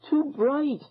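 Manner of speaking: a lightly voiced girl shrieking at the light; a girl half-screams at the glare; girl's mock scream. A narrator's reading. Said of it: "a girl screamed".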